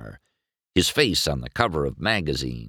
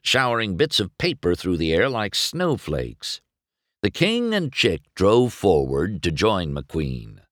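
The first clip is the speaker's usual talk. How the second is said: ordinary delivery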